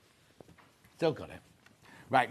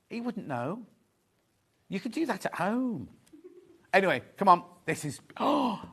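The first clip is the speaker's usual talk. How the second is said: normal talking voice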